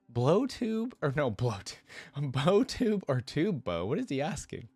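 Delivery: high, amused, stumbling over the words; baffled laughter. Real, not acted. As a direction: repeat it incredulously through laughter, pitch leaping. Reader puzzles over the line, laughing.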